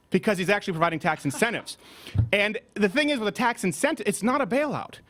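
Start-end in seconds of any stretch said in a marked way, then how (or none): none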